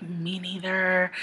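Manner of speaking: slow hushed whisper of agreement; conspiratorial whisper. Retold or imitated. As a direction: whisper it like a shared secret. Secret gossip about [name].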